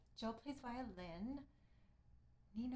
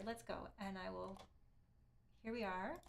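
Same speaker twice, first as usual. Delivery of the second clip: ordinary delivery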